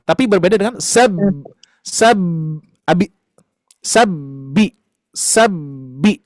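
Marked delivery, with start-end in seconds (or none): 1.8-6.2 sab, the b bouncing lightly; echoed b in sab. Shown as a demonstration. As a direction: ring out the b in sab. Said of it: "bisa gak mendengar sedikit suara di huruf ba itu".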